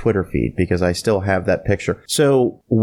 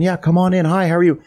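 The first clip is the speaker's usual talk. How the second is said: high-pitched host's welcome; welcoming greeting; 8 semitones higher than usual. Retold or imitated. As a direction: greet a customer, pitched well up. Acting his routine greeting to customers.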